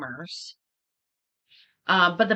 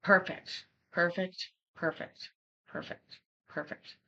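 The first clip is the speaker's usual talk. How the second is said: fading repeat; 8 dB quieter than usual. Real, not acted.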